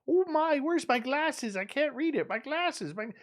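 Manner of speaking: high, fussy granny voice, searching for glasses; high fluttery mock granny; flustered old lady. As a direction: play a dithering grandma who's lost her glasses. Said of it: "she's like Thelma".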